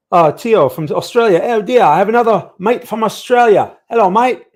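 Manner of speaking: high, loud put-on Australian accent. Streamer greeting a viewer from Australia, mock Australian accent. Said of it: "That's my Australian accent".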